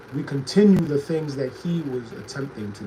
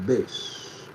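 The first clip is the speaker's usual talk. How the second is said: bass voice; 5 semitones lower, 5 dB quieter than usual. Shown as a demonstration. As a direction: say it low and soft, in a bass voice. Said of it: "there's bass in my voice".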